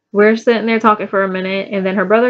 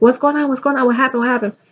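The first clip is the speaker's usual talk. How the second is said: higher-pitched, rapid-fire questions; mock-panicked grandma; retold alarm; 4 semitones higher, faster than usual. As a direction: imitate a worried grandma firing questions. Retells alarmed grandma running out.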